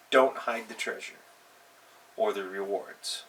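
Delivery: ordinary talk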